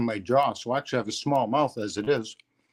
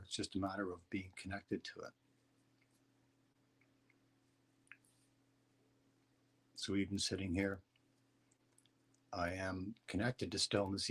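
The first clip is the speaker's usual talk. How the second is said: soft, low meditation guidance; guided meditation; 3 semitones lower, 11 dB quieter than usual. Acted. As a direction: calmly guide them into stillness. Leads a meditation.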